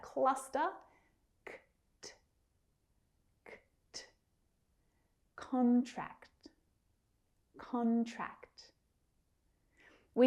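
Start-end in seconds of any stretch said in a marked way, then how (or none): 1.5-4.0 bare K and T clicks, no vowel; isolated cluster consonants. Shown as a demonstration. Teacher drawing learners' attention to the cluster, K and T sounded alone. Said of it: "that double consonant at the end". 5.4-8.7 "Contract" drawn out, both final K and T sounded; clear final cluster. Shown as a demonstration. Teacher showing learners the end cluster, "Contract" with audible K and T. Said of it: "double consonant at the end".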